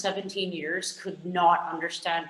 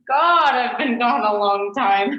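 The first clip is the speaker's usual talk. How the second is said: loud, drawn-out, higher outburst; real exclamation; 4 semitones higher, 9 dB louder than usual. Real, not acted. Expat realizing how long she's been away, loud exclamation. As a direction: cry it out, stretching the words. Sudden dismay at own absence.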